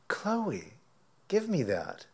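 high, sing-song coaxing of the dog; high coaxing call to a dog. Retold or imitated. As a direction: sweetly coax the pet to let go. Coaxing the dog to drop steak.

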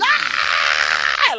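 long, loud harsh scream; imitated screaming. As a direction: scream while laughing out of control.

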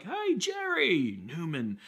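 high, slow, drawn-out hello; a warm mock greeting to a known caller; cheery phone greeting. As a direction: greet a familiar caller with a sing-song hello. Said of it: "when we're like".

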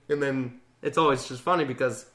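normal talking voice

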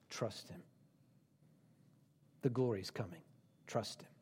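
quiet tender appeal. Acted. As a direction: draw them close, almost hushed.

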